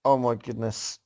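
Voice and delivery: ordinary talk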